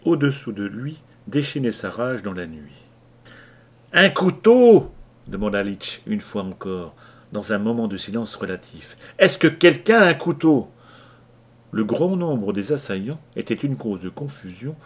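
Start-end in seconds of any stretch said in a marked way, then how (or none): 3.9-4.9 slow, loud, high demand; urgent shout. Acted. Leach again demanding a knife, shouting. 9.2-10.7 loud, high pleading yell; urgent shout. Acted. Leach asking the crew for a knife, shouting.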